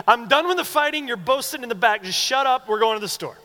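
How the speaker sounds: high, loud parental scolding; mock fed-up parent. Parent to kids fighting over front seat, mock shouting. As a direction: imitate a parent yelling over squabbling kids. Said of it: "what we do with our kids".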